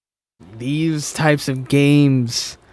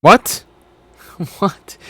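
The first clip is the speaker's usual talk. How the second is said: incredulous what; 5 semitones higher, 6 dB louder than usual. Real, not acted. Incredulous outburst.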